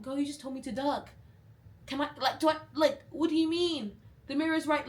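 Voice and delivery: high, loud baffled objection; exasperated protest. Retold or imitated. Acted baffled protest to seller.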